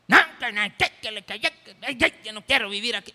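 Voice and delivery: a woman shouting she won't live here; angry shout. Acted. Preacher acting a wife yelling at her husband. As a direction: yell it out, flustered and stammering.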